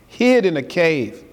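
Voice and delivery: ordinary delivery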